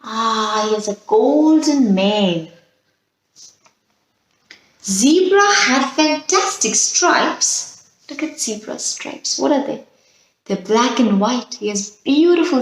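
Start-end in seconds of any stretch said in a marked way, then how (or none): 4.8-7.9 storybook reading. Acted.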